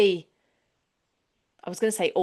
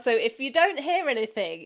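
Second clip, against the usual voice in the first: normal talking voice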